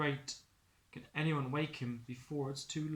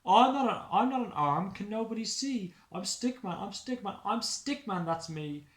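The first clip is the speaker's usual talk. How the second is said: high pleading, fading at the end; despairing Stickman; 4 semitones higher, 6 dB louder than usual. Acted. Stickman laments to the snowboy, high and despairing. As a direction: ask if he'll ever get home.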